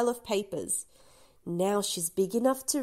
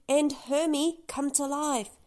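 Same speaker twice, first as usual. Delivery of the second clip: plain reading voice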